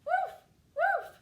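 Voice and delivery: high, loud puppy woofs; two sharp, high-pitched dog barks. A narrator's reading. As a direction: bark like an excited little puppy. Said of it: "her puppy says".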